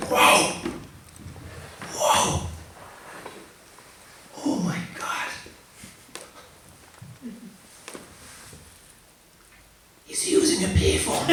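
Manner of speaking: stunned near-whisper with long pauses; hushed, hammed-up disbelief; awed hush. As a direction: whisper it slowly in mock amazement. Stunned aside after witnessing payphone rant.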